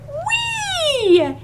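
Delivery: very high, squealing frog "Wee"; frog's happy cry. Acted. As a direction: let the frog squeal with joy. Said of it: "a frog who cried".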